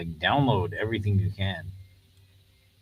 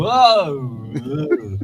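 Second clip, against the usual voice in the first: mock dizzy; 6 semitones higher, 7 dB louder than usual. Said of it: "and he's like".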